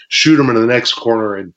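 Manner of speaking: ordinary talk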